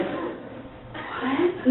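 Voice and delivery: breathless whispered what with a slow rise; stunned whisper. Retold or imitated. Wife stunned by the pastor's news, whispering in shock. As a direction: gasp it out, frozen in shock. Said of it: "like a deer caught in the headlight".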